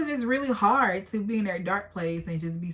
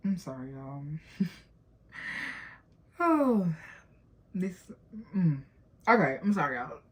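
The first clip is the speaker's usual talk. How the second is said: real tears, a shaky laugh, then gathering yourself; choked up. Real, not acted. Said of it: "Being a little vulnerable".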